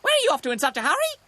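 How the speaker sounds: high, loud, rapid magpie call; excited high-pitched hail. A narrator's reading. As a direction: call out in a piercing cartoon bird voice. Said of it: "Sticky called".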